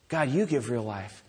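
low, soft appeal to God; softly voiced surrender; hushed prayer. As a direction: pray it quietly, voicing a man at rock bottom. Voicing Jonah's prayer from rock bottom.

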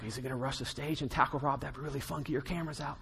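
joking under the breath; half-hearted whispered worry; mock scared aside. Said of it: "Maybe a little scared, like".

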